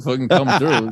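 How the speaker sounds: usual speaking voice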